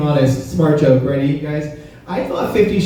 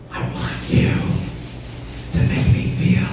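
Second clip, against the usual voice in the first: breathy mock whisper, softer; lover's whisper in the bedroom, hushed and softer; imitated whisper; 4 semitones higher, 6 dB quieter than usual. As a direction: whisper it like a come-on in bed. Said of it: "she whispers to me".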